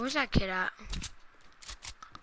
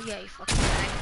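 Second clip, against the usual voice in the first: normal talking voice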